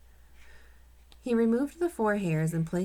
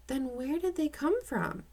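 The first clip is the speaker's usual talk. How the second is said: put-on higher girl's question; 9 semitones higher than usual. A narrator's reading. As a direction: lift into a young girl's voice. Girl voiced in read story.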